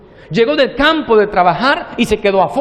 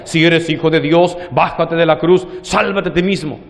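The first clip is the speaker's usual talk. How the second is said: mocking taunt, a touch lower; mocking crowd; 6 semitones lower than usual. The impious crowd taunting Jesus on the cross, lightly mocking. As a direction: jeer at him to come down. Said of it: "La gente impía le decía".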